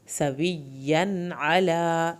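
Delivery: سویًّا with the y doubled and held; slow recitation. Shown as a demonstration. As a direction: linger on the yaa in سویًّا. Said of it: "یا کی شد پہ دیر لگائیں".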